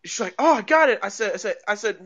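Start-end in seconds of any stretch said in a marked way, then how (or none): 0.4-1.0 mildly excited, higher and louder; retold excited cry. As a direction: quote her little burst of triumph. Retelling the woman's catch.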